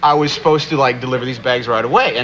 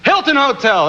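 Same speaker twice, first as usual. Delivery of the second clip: bellhop call; 11 semitones higher than usual. Retold or imitated. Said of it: "that's what I said".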